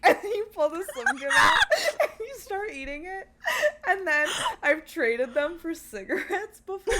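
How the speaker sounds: high, loud, laughing through the words; helpless laughter breaking into the story; cracking up. Real, not acted. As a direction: tell it while losing it laughing. Cracking up while telling story.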